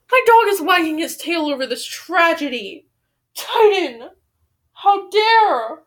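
indignant scolding. Real, not acted. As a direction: scold the dog in dramatic offence. Scolds dog for wagging at tragedy.

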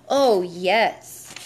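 put-on emphatic, wholehearted child's assurance; assuring. A narrator's reading. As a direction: assure Dad with relish, drawing out the words. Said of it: "John assured him".

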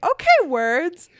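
loud, slow, drawn-out mimicry; imitating a rude cutting-in remark; mocking interruption. Mimics man who cut them off.

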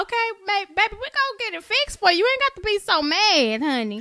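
high, sweet, fast soothing of a rude diner; syrupy high "baby, honey" voice; sugary server voice. Retold or imitated. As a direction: placate the angry customer sweetly. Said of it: "I would be like".

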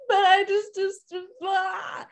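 high, sing-song helpless whine trailing off; mock whiny wail. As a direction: imitate a frustrated child who can't find words. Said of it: "and we are just like".

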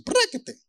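very high sharp mock slash noise; playful sound effect of a blade chop; slicing sound. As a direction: spit out a quick cutting sound effect. David beheads Goliath.

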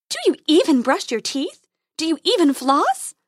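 high, loud scolding; scolding fairy. A narrator's reading. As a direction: scold like a dentist.

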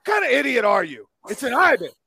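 put-on yelling at a kid; loud, scornful outburst; angry shout. Drunk friend yells at kid.

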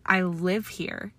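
ordinary delivery

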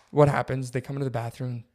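ordinary delivery

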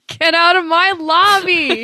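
high, loud, drawn-out mock outrage; Mister Mosby. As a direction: shout it slowly through laughter as a hotel manager. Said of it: "Mister Mosby".

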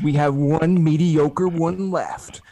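slow, loud movie trailer narrator; trailer voiceover. Pretend. Mock movie trailer voiceover for the podcast mockumentary. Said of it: "get [name] to do the voiceover".

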